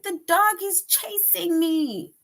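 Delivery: high, urgent cry; alarmed exclamation. Shown as a demonstration. Teacher acting a child chased by a dog, high alarmed cry. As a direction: call out like you're being chased. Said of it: "if the dog is chasing you and you run".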